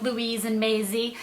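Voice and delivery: slow, careful English accent on the names; mild attempt at an English accent. Shown as a demonstration. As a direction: say Maisie like the British TV host. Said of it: "I said Maisie just like Big Brother says Maisie".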